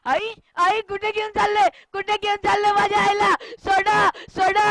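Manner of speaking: high, loud, rapid cries; panicked shriek to let go; frantic child screaming. Acted. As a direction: shout desperately after mother. Child screams as mother is taken.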